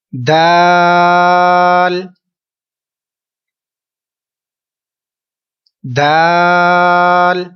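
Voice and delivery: दाल held very long and even, about three seconds; drawn-out recitation. Shown as a demonstration. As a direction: recite दाल slowly, stretching the vowel. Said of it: "चलते हैं अगले हरूफ".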